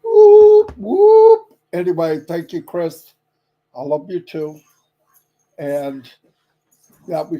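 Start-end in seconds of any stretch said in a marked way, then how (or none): 0.0-1.6 loud high whooping cheer; real party whoop to close the stream. Real, not acted. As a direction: whoop like a hype man. Celebratory cheer after sign-off.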